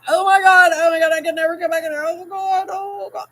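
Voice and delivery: high, fast, fading freak-out; mock mortified panic. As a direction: act out panicked embarrassment. Imagined panic at being caught.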